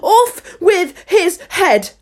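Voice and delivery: slow, high, loud royal scream; raging queen's death sentence; furious shouting. A narrator's reading. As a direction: shriek each word in a furious passion. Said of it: "stamping about and shouting".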